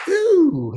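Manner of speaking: a yelped rock-star ooh, way up high. Retold or imitated. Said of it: "a couple of [name] moves".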